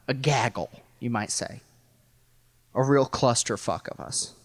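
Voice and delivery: normal talking voice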